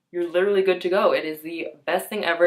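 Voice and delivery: ordinary talk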